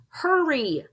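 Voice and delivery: high loud yell; frightened plea. Acted. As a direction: urge him on with a shout. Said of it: "I yelled".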